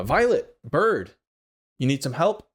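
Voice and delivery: lightly voiced tabaxi man calling out. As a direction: hail them from a distance.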